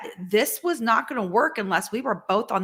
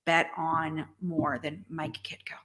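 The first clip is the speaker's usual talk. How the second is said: soft, slow, lower whisper; 5 semitones lower, 7 dB quieter than usual. Retold or imitated. Wife telling her husband she would bet on him, whispered. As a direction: breathe the name out quietly, in awe.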